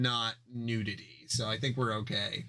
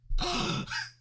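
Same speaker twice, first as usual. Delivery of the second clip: shocked gasp. Acted. Shocked moment in the comic.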